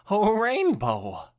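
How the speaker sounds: loud, slow startled cry of a name; startled greeting. Acted. Twilight caught off guard by Rainbow, startled. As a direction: jump and blurt the name out.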